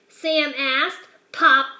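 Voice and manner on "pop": loud popping pop; pop sound. A narrator's reading. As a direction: pop it out like a bubble. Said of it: "When you come to a period, say pop".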